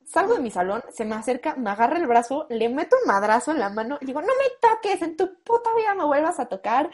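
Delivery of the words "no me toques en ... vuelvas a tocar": higher, heated yelling at him; retold shouting. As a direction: scream at the boyfriend in front of everyone. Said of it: "una santa gritoniza". (gritándole al novio frente a todos, más agudo)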